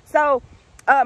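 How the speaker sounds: ordinary delivery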